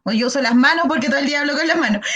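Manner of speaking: ordinary talk, nothing marked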